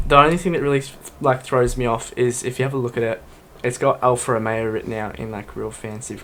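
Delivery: usual speaking voice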